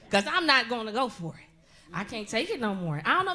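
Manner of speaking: normal talking voice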